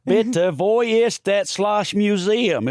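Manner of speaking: mock German tourist in a hillbilly accent. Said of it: "German spoken with a hillbilly accent".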